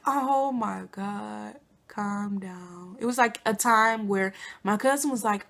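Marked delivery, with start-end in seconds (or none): 0.1-3.0 slow deadpan. Retold or imitated. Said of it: "I'm like".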